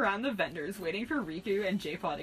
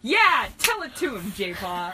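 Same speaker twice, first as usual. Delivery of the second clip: cheering; 10 dB louder, slower than usual. A narrator's reading. Narrator backing up the kid in the backseat, cheering. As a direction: cheer them on loudly. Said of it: "I cheered".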